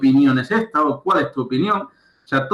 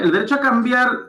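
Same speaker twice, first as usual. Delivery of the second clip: "cambiar" lifted much higher at normal loudness; second reading, higher with "cambiar" stressed; higher repeat; 9 semitones higher than usual. Shown as a demonstration. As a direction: repeat "El derecho a cambiar" pitched well up.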